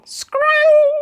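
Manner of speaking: very high, loud scrang; scraping sound word. A narrator's reading. Narrator sounding the bumper snagging, very high. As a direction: screech it out.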